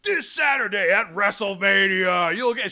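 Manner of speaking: hammed-up wrestling promo read; mock hype announcer. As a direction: sell it like a pay-per-view promo. Mimicking radio ad pitch.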